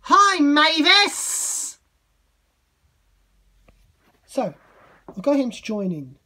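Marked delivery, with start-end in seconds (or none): 0.0-1.8 high, loud, drawn-out hello; loud greeting call. Pretend. Greeting Emily and Mavis at play, loud high call. Calling out to the toy engines.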